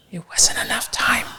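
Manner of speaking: hushed, breathy aside; whispered punchline. Real, not acted. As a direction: drop to a whisper for the reveal. Confides the outcome, repeating it.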